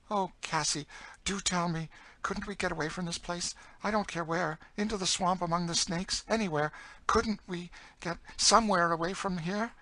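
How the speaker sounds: high, urgent story voice; desperate high-voiced plea; pleading young woman character. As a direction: beg for a way out, pitched well up. Emmeline begs to escape.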